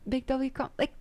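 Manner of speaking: ordinary talk, nothing marked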